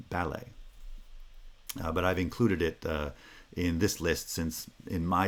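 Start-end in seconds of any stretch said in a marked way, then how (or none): 0.1-0.5 ballet stressed on the first syllable, BAL-lay, like pity; British ballet. Shown as a demonstration. Teacher showing British ballet, stress on BAL. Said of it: "it would sound more like, uh, pity".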